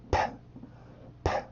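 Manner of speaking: puffed 파 with a burst of air from the lips; P sound demo. Shown as a demonstration. Teacher demonstrating 파 to students, with a puff of air. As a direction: spit 파 out like blowing paper off the lips. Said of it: "이렇게 입술에다가 얇은 종이를 물고 있다가 멀리 뱉어낸다는 느낌으로".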